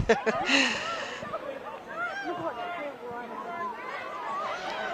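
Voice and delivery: long high giggling laugh; high laughing fit. Real, not acted. Laughing at the short corner joke.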